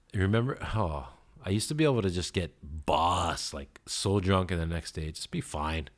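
usual speaking voice

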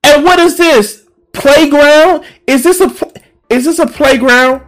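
stunned furious questions; outraged disbelief. Real, not acted. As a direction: cry out at the absurd office. Outraged at office photo.

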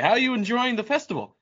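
high-pitched mock TV reporter; put-on interview voice, high; mock interviewer. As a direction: play an eager interviewer putting questions, pitched well up. Mock festival interview continues.

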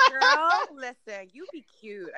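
drawn-out mock Valley Girl; Valley Girl accent. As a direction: put on a slow Valley Girl voice. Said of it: "like your Valley Girl thing".

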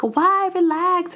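high-voiced mock friend; imitated friend's reaction. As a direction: pitch it way up, telling someone to chill. Girlfriend's retold reaction to panic.

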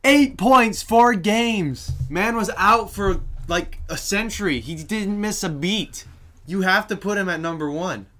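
fired up, arguing his pick hard; excited. Real, not acted. Insisting Sid deserves number one.

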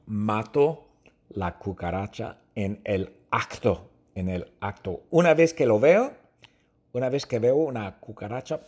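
ordinary talk

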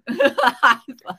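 a real burst of laughter; loud laugh. Real, not acted. Joking about calling mom fat, loud laugh.

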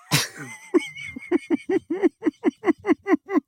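drawn-out high laughter; long high laugh. Real, not acted. Laughing at the boxer shorts story. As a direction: laugh helplessly at the memory.

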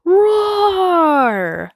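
high breathy roar sound; cub's hushed roar; whispered roar. A narrator's reading. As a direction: roar as loud as a cub can. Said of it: "And then Kion roars".

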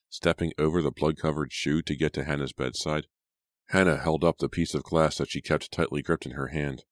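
usual speaking voice

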